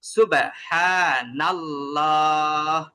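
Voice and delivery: সুবহানাল্লাহ drawn out slowly with a heavy lam; heavy lam recitation. Shown as a demonstration. Teacher recites সুবহানাল্লাহ as the next example. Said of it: "যেমন".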